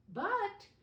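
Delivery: sudden high, loud but; teacher drawing children in; excited exclaim. Acted. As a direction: pop up with wonder. Teacher building suspense.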